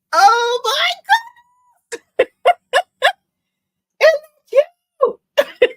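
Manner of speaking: high, loud squeal over it; squealing delight. Real, not acted. Embroiderer squealing to viewers over the pink turkey. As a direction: squeal like it's adorable.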